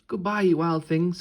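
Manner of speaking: put-on high, loud girl calling goodbye; little girl calling. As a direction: call after the animals as a little girl. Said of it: "said Red".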